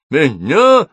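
high, loud, startled squawk; frightened crackle. A narrator's reading. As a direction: yelp it as an alarmed fire demon. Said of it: "затрещал Кальцифер". (испуганный возглас демона)

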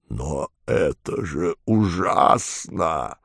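mock tearful wail; whining howl. A narrator's reading. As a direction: wail like a frightened boss. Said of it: "плаксиво взвыл неведомый великий".

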